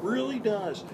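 very high, soft, slow-drawn imitation; Owen Wilson. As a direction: imitate a dreamy actor admiring someone, voice way up high. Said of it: "if Owen Wilson was a pilot".

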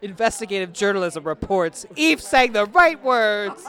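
playful mock reporter announcement, laughing; mock news report. Friend ruling on the lyrics dispute, mock news report. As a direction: announce it like a jokey breaking-news verdict.

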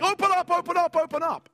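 loud pounding police shout at the door. Retold or imitated. Police at his door at three in the morning, loud shout. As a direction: bellow it like riot police waking him.

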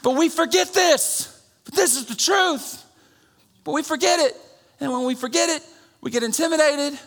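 high, loud shouted preaching; full-force preaching. Real, not acted. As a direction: lift the church with a shout.

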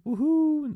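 high, loud gleeful whoop; excited whoop. Real, not acted. Plugging his twitch channel, a loud gleeful whoop. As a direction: let out a big happy whoop. Cheering own shameless plug.

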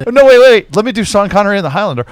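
excited Scottish-accented Connery mockery; Sean Connery impression. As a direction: burst out in a Scottish burr. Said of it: "he's like".

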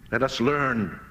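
normal talking voice

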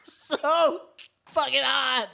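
high, slow, laughing shout. Pretend. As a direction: yell it out half laughing. Shouted confession to Zuckerberg.

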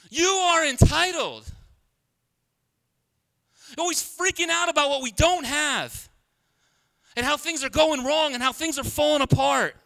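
ordinary talk

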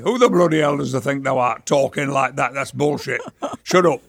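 quick, scoffing broad Yorkshire; mocking Yorkshire dialect. Friends jeering at how he talks now, mocking Yorkshire dialect. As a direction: scoff in thick Northern England dialect. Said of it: "That is what they'd say".

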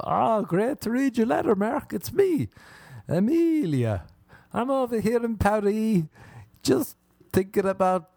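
put-on high, sweet girlish letter voice; fake French girl. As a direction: play the too-perfect pen pal girl. Voicing fake French pen pal.

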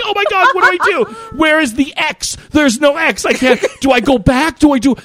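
high, frantic flustered questions; acted panic. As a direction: hunt desperately for a way out. Acting out panic over send button.

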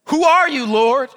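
high, loud, startled cry; startled Saul. Acted. Saul on the road calling out, startled and loud. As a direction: cry out in shock at an unseen voice.

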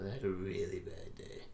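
soft, breathy whispered Joker line; Joker quote. Retold or imitated. Quoting the Joker to a friend, a soft whispered line. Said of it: "Oh yeah".

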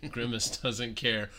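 usual speaking voice

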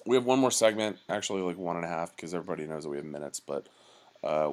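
usual speaking voice

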